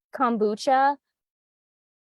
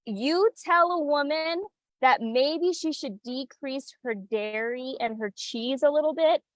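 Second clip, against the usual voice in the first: ordinary talk, nothing marked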